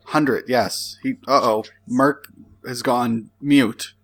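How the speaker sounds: normal talking voice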